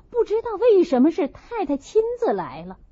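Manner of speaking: ordinary delivery, nothing marked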